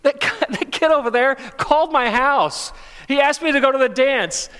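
a lightly voiced teen girl laughing at him; mocking girl. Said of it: "She was telling everybody".

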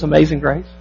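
soft, quiet echo of Jessie's answer; a light imitation of a quiet answer; quoting Jessie softly. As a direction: say it gently, as she said it. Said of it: "I want to make sure I quote you right".

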